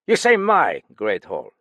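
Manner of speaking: loud, curt snap; sharp indignant retort; snapped. Acted. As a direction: bite the words off in indignation. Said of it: "Galland snapped".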